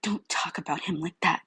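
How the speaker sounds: hissed furious whisper; venomous whispered warning; spat whisper. Acted. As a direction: spit the words out under your breath. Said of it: "she spat".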